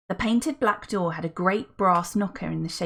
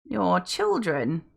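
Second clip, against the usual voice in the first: very slow, raspy posh voice; posh old butler; much slower than usual. Acted. Welby the butler speaks.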